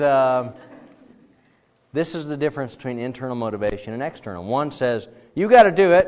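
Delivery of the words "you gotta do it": louder, firm command; authority figure laying down an order, loud. Retold or imitated. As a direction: bark the order. Command given.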